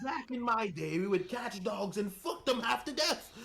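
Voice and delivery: high, put-on old codger voice; high mock old-man voice with a shout at the end; old British man impression. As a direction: ham up a grumpy old Brit reminiscing. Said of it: "the most ... I've ever heard in my life".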